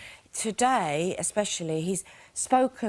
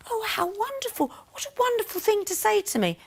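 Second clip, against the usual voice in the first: much higher, sugary put-on voice; mock gushing reply; 10 semitones higher than usual. Housemate mocking the reply locals would never give, high and gushing. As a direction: gush like a flattered lady, all fake delight. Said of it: "They wouldn't go".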